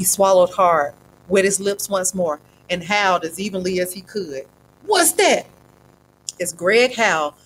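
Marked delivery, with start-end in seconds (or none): 4.8-5.5 startled cry. Acted. Startled thug hears a howl.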